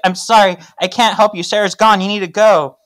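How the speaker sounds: high, loud acted brush-off; mock urgency. Patience nervously sends Paige away.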